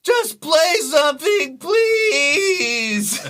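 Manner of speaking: high, loud, slow plea with a laugh; exasperated audience member calling out; heckler shout. Retold or imitated. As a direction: yell from the crowd, fed up. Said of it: "someone in the audience goes".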